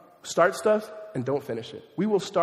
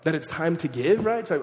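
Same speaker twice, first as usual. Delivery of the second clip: ordinary delivery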